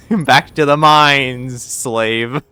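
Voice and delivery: slow, loud, laughing order; laughing mock command. Streamer jokingly orders her mod back to work, laughing and loud. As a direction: boss the mod around as a joke.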